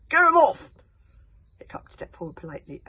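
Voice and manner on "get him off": high, loud demand; angry shout. Acted. Chief berserk shouting to get the dragon off. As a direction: bellow the order.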